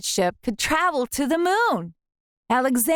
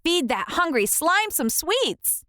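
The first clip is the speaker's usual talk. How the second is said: urgent high order; rally the kids; eager commanding kid; 6 semitones higher than usual. A narrator's reading. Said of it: "I commanded".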